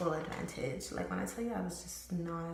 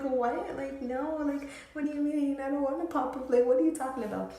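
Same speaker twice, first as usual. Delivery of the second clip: higher, louder incredulous protest, speeding up at the end; shocked disbelief; 8 semitones higher, 5 dB louder than usual. Retold or imitated. Refusing best friend's plan to pop up, in disbelief. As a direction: replay your shock at her plan.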